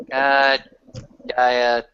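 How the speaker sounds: ordinary talk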